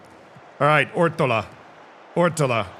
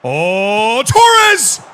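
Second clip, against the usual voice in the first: rising oh into a high, loud yell; excited shout; 5 semitones higher, 7 dB louder than usual. Real, not acted. Streamer reacting to Torres hitting the post, excited shout. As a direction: build from a long oh to yelling the name. Near goal in live game.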